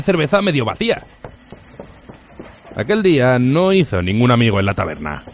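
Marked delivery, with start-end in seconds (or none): none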